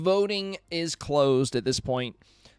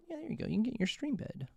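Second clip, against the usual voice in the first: pet talk; 7 semitones higher, 8 dB quieter, faster than usual. Real, not acted. Settling the cat.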